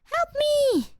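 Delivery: high, loud mock plea in the cat's voice; squeaky cat cry for help. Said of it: "He's like".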